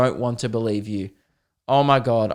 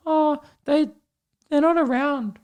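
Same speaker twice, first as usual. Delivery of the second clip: put-on much higher small child voice; sad kid voice; 13 semitones higher than usual. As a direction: answer like a neglected little kid. Covid kid answers teacher.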